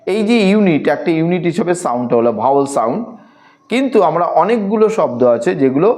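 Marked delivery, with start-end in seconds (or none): none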